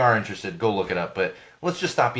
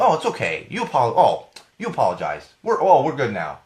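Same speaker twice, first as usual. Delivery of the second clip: mock apology; 2 semitones higher, 5 dB louder than usual. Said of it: "does it really work that way?".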